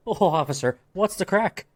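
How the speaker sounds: high, cheeky leprechaun jab over a kill; gleeful leprechaun taunt. Pretend. Leprechaun taunts the officer after the kill, high and gleeful. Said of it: "accompany that with".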